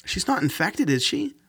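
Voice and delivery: mild alarm. Acted. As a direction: fear creeping into the question. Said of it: "she asked, alarmed".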